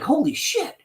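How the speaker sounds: high stunned exclamation; amazed. Retold or imitated. Buddy stunned by the big wad of chips, high amazed exclamation. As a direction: blurt it out in total disbelief.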